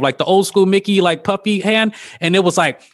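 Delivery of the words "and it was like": usual speaking voice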